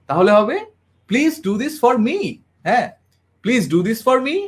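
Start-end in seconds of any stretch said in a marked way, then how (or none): 1.1-2.3 "Please do this for me" with the end on "me" lifted up high, slow; rising ending. Shown as a demonstration. Trainer demonstrating the rising tone to the class, ending "me" high. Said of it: "উপরের দিকে যদি হয়". 3.4-4.4 "Please do this for me" with the tune rising on "me"; rising ending. Shown as a demonstration. Trainer repeating the upward-ending demo, rising on "me". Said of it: "এটা হচ্ছে উপরের দিকে".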